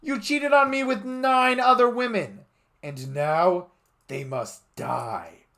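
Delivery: high loud yell slowing down; hammed-up furious yell. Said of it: "she yelled".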